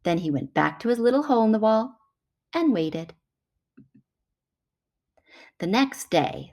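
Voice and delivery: ordinary delivery, nothing marked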